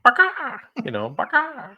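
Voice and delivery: raspy cawing crow; raven caw. Retold or imitated. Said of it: "that was my Raven impression".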